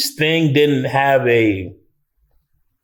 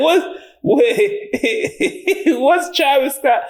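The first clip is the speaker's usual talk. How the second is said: high-pitched, incredulous laughing reaction; laughing disbelief; 11 semitones higher, slower than usual. Retold or imitated. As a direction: crack up in baffled surprise. Reacting to surprise rapper cameo.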